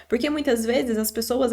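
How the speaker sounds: ordinary talk, nothing marked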